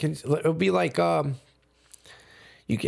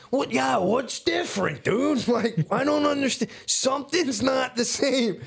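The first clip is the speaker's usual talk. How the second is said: high-pitched bewildered stoner quote; dusted, confused stoner; 9 semitones higher than usual. Retold or imitated. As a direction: act out being high and baffled, pitch way up. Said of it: "I'm so dusted out. I'm like".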